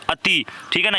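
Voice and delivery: ordinary delivery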